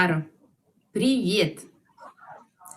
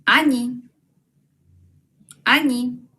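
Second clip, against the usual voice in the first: Они said louder and a bit higher; loud model word; 3 semitones higher, 4 dB louder than usual. Shown as a demonstration. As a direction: call Они out clearly for repeating. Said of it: "ellos se dice они". (en voz alta para repetir)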